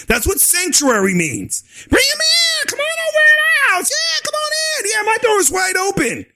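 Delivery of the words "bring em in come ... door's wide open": sing-out mock welcome, pitched way up; mock calling out. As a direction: holler a sarcastic invitation, stretching every phrase. Mockingly calls everyone into his house.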